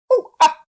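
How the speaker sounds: loud, high monkey "ooh, ah!"; high two-note primate whoop; monkey cry. A narrator's reading. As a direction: screech a short monkey greeting. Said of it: "and said".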